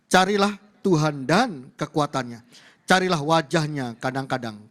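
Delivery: slow, forceful group-reading lead; loud proclamation. Real, not acted. Preacher leading the congregation through the verse, loudly proclaiming. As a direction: project each phrase to the whole congregation.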